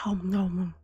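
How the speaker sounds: breathy startled whisper. Real, not acted. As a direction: whisper it under your breath. Sudden soup-burn whisper.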